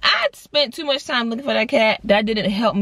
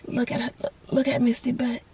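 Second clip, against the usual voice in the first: softer, hushed sigh then pointing out; hushed alarm; 6 dB quieter than usual. Retold or imitated. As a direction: breathe out then hush it to dad. Pointing out cat in labor.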